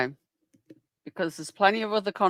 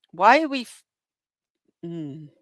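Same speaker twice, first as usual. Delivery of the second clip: ordinary talk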